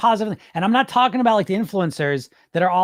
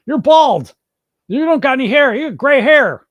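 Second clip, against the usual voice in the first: much higher, louder sneering jeer; mocking troll; 9 semitones higher, 9 dB louder than usual. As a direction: ham up a childish troll insult. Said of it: "people out here love to troll".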